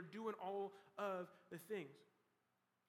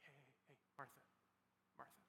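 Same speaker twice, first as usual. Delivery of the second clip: much softer, lower whispered "Martha, Martha"; whispering; 6 semitones lower, 18 dB quieter, much faster than usual. Retold or imitated. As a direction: lean in and whisper "hey, Martha". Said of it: "leaning over and whispering".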